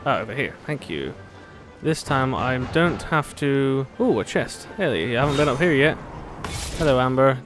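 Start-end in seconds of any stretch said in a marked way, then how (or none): none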